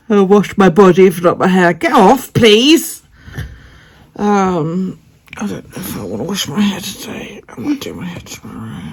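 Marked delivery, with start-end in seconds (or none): 5.4-8.8 soft breathy whisper. Real, not acted. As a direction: whisper it so he won't hear. Unvoiced whisper lines.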